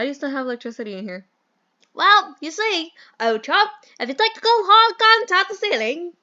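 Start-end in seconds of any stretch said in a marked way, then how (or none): 1.9-6.1 high, loud stagey British gent; bad British accent. Mentor explains the electricity, over-the-top British accent. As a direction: explain like a hammed-up British chap. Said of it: "do the worst British accent".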